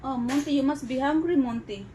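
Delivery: normal talking voice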